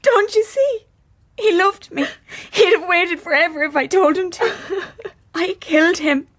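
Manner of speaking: high, tearful voice with a broken laugh; on the edge of tears, blaming herself; anguished grief. Acted. Siobhan confessing to her sister, tearful and high.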